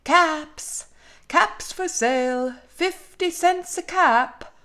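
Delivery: high singsong street cry, drawn out; peddler's call. A narrator's reading. Peddler walking the street, calling out his caps. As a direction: call out wares to passers-by. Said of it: "walked up and down the street calling".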